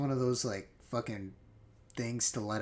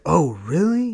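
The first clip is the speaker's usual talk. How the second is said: excited; 4 semitones higher, 11 dB louder than usual. Retold or imitated. Eager reply to offer.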